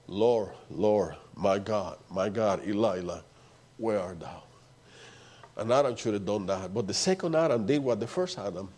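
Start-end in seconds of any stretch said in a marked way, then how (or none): 0.1-4.4 low, hushed anguished cry retold; Jesus on the cross. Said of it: "he cried a loud voice in the cross".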